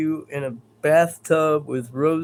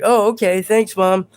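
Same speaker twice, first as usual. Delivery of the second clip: put-on a touch higher, louder little kid; lightly voiced kid; 5 semitones higher, 4 dB louder than usual. Kid in the joke thanks his mom, lightly voiced. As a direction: chirp it like a satisfied child. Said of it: "And the kid was like".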